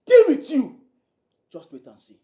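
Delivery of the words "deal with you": high, loud menace; shouted threat. Acted. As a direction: hurl the threat at her. Threat to gold digger.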